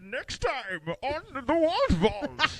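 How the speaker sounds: high, slow, laughing bad villain impression; hammed-up Bane, cracking up. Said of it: "Say it as Bane".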